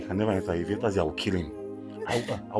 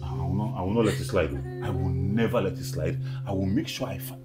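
low, controlled vow; grim resolve; 6 semitones lower than usual. Acted. Man vows not to let the beating slide, grimly resolved. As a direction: hold the anger down low. Vowing to punish the abuser.

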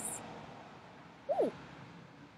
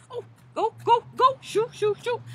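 louder, higher shoo-shoo at the flies; mock shooing; 7 semitones higher, 4 dB louder than usual. Mother Goose shoos flies away, loud mock shout. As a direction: chase them off with a big shout.

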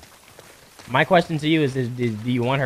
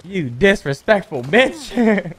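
mock insult; 7 semitones higher, 8 dB louder, much slower than usual.

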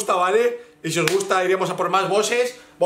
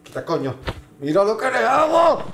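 ordinary talk, nothing marked

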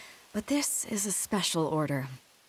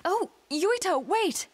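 calling out; 12 semitones higher, slower than usual. Acted. Calling after running child.

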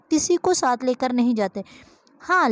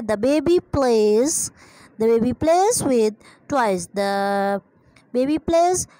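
slow dictation; much slower than usual. Shown as a demonstration. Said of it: "इसको आप solve कर सकते हैं".

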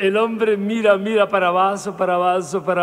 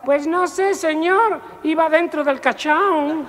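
servant punchline; 10 semitones higher, 5 dB louder, slower than usual. Acted.

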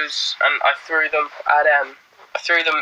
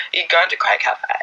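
put-on mumbled American accent; 4 semitones higher than usual. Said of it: "people actually talk like that".

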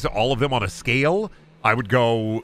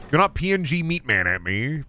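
usual speaking voice

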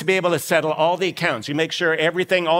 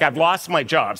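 usual speaking voice

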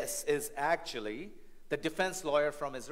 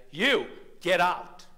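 stern dismissal; 7 semitones higher, 9 dB louder, slower than usual. Pretend. Judge throwing lawyer out.

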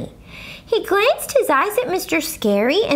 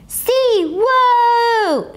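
high loud astonished whoa; higher louder kid amazement; amazed whoa; 12 semitones higher, 4 dB louder than usual. Acted. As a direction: marvel out loud. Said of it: "said my other friend Jose".

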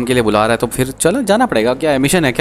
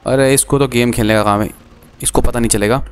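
usual speaking voice

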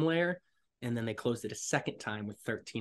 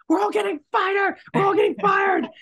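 much higher, much louder near-crying shout; frantic shout; 14 dB louder than usual. Retold or imitated. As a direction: director panicking on set. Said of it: "he's like".

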